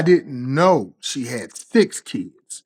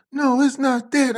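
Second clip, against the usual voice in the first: much higher, mock girlfriend answering; girlfriend's voice; 12 semitones higher than usual. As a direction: put on her high, excuse-making voice. Girlfriend voiced in retold quarrel.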